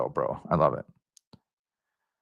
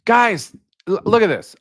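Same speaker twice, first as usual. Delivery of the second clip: much higher and louder, urgent call to the chat; alarmed; 11 semitones higher, 6 dB louder than usual. Real, not acted. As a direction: grab everyone's attention in shock. Shocked by missing notifications.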